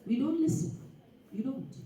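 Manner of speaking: ordinary talk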